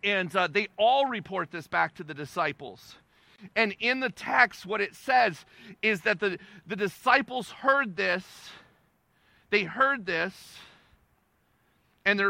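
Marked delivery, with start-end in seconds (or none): none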